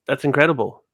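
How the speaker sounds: ordinary delivery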